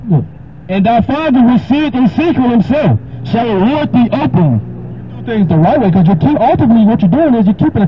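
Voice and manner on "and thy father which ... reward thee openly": raised, solemn King James reading; scripture reading. Acted. Preacher reading Matthew six to a caller, solemn. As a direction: read it like holy writ.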